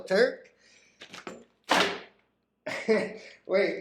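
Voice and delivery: ordinary delivery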